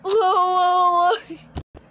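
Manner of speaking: high, loud wavering wail; loud wailing cry. Acted. Puppet in the acted scene, loud high wail. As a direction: wail loudly on a wavering note.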